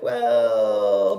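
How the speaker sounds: long, loud drawn-out hedge; evasive stalling. Retold or imitated. Partner dodging the exclusive question, exaggerated evasive stalling. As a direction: stretch it out as a mock stall. Acts out partner evading commitment.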